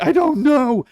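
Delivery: put-on loud, high upset kid; high whiny reply. Kid answering parents at dinner, high loud whine. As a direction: wail it like a frustrated kid. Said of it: "I'd be like".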